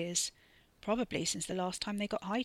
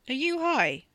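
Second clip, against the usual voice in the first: accusing; 7 semitones higher, 6 dB louder than usual. Acted.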